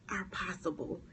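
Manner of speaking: ordinary talk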